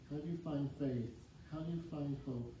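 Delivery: normal talking voice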